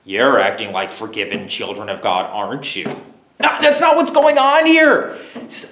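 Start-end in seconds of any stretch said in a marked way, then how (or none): 0.0-2.9 mock passive-aggressive jab, pitched down low. Preacher showing how Paul does not talk, passive-aggressive. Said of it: "some sort of passive-aggressive way going". 3.4-5.2 high, loud burst of protest; emphatic shouted denial. Real, not acted. Preacher rejecting the mock version, emphatic shout.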